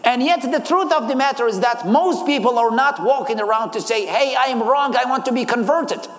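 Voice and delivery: usual speaking voice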